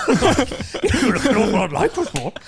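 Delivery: laughing imitation. Imitating a fan who recognised him, laughing. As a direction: laugh through the fan's line. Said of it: "he was like".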